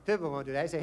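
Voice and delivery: ordinary talk, nothing marked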